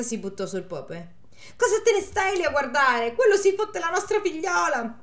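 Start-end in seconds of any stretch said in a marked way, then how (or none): 1.6-5.0 indignant wife. Acted. Wife yelling at priest.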